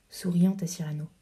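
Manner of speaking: ordinary delivery, nothing marked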